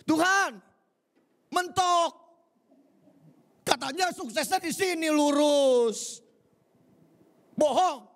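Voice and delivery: high, whining protest to God; a petulant believer whining up at God; whiny complainer. Acted. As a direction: act the sulking believer who hit a wall. Acted frustrated believer complaining to God.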